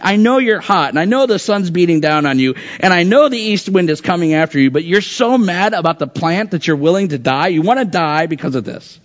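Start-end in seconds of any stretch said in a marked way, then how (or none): none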